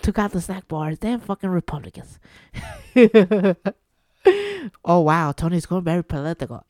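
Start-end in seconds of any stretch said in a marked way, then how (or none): 2.9-3.7 a loud burst of real laughter. Real, not acted. Podcaster laughing at his own joke about Republicans. Laughing after political jab.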